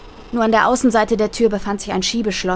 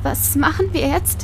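higher, slow, fragile question; brittle voice; 7 semitones higher than usual. A narrator's reading. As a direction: ask it slowly, voice close to breaking. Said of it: "fragte meine Cousine mit brüchiger Stimme". (mit brüchiger Stimme)

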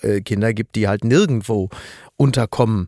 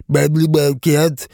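Helmut Kohl voice. Pretend. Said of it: "Ich kann das nicht so gut nachmachen".

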